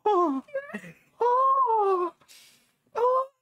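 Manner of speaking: very high rising crying moans; pained crying moans. Real, not acted. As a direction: moan in rising pain. Hot sauce burning mouth.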